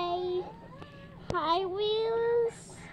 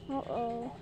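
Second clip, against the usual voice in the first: normal talking voice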